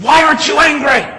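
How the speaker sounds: high, very loud imitated bellow; angry retold shout. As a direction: shout it as the enraged policeman. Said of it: "He said".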